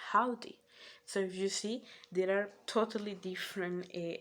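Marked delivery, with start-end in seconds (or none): 0.1-0.6 a louder, lifted Southern "Howdy."; mock Southern drawl greeting. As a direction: belt out a friendly Southern "Howdy.". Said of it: "a Southern American will say".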